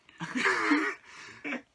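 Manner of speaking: high helpless giggling fit; choking laugh. Real, not acted. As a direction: laugh until you can't breathe. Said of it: "or do you wanna just keep choking?".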